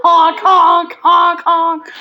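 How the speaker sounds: loud honking noises; car horn honks. Pretend. Driving home in pretend car.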